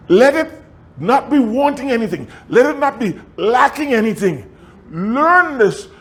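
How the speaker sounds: high, ringing preacher voice; preaching. Acted. Pastor exhorting the congregation, high and ringing. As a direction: preach it up to the rafters.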